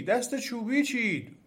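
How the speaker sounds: high, drawn-out alarm cry; trees shouting together. A narrator's reading.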